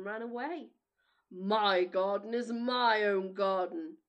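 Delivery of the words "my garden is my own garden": loud, slow, booming giant; gruff giant voice. Acted. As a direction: boom it like a selfish giant. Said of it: "said the giant".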